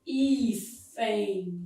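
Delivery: teacher lingering on both syllables of "hífen"; demonstrated diphthong. Shown as a demonstration. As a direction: sound out "hí-fen" slowly, ending in "ein". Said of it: "Aqui eu tenho um ditongo".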